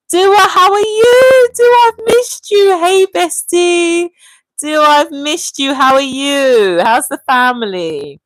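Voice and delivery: high, gushing hello, drawn-out words; delighted greeting. Real, not acted. Streamer welcomes Dua back to the chat, delighted. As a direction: greet a long-missed bestie, pitch soaring then easing down. Excited greeting to returning viewer.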